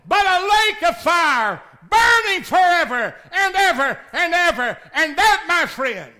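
loud, high, driving pulpit cry; preaching at full force. Acted. Preacher to congregation, high loud preaching. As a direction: thunder it at the congregation.

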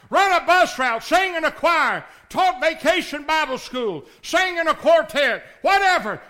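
loud, high, driving pulpit cry; preaching at full force. Acted. Preacher to congregation, high loud preaching. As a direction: thunder it at the congregation.

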